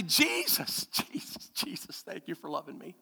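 breathy, softening into a whisper; tender prayer. Pretend. Believer thanking Jesus in a fading, tender whisper. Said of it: "come and say".

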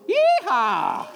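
whooping high yeehaw cheer. Real, not acted. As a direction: let out a loud cowboy whoop. Cheer after birthday song.

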